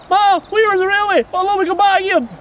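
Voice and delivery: high, loud, breathless blurt of a confession; imitating a wee boy spilling the secret to his mother. Retold boy blurting the truth to his ma, high and loud. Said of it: "as soon as he met his ma".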